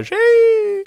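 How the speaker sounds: very high-pitched exclamation; excited catchphrase. Shown as a demonstration. Showing the knee-jerk reaction to exciting things, high excited catchphrase. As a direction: squeal it like a celebratory reflex.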